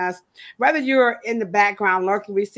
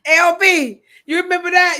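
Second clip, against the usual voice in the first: higher, louder excited greeting; excited call-out; 8 semitones higher, 8 dB louder than usual. Real, not acted. Host hailing LB in the chat, excited. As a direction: call out to a friend, pitched up.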